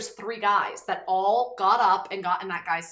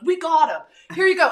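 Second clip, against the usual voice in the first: higher, louder, cheerful handling it; confident helper; 8 semitones higher, 10 dB louder than usual. Retold or imitated. Guys restraining the man, loud and cheerful. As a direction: sound like you've got it covered. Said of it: "And, like".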